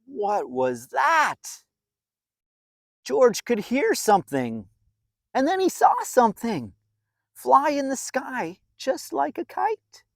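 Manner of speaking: put-on lively read-aloud voice for a children's story; storybook narration. A narrator's reading. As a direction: tell it like a bedtime picture book.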